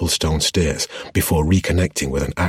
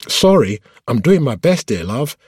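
high, raised driver's voice; calling back; 8 semitones higher, 5 dB louder than usual. A narrator's reading. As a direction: call it back over the chaos. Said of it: "the driver called back".

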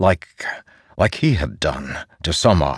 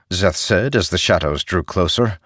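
plain reading voice

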